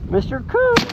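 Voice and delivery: high squeaky call to a dead bird. Pretend. Calling to the shot coot again.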